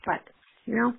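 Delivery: ordinary talk, nothing marked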